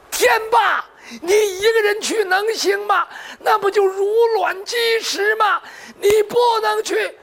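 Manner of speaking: high, pressing plea to hold him back; urgent dissuasion. Acted. Friend pleads against suicidal revenge. (焦急高声劝阻)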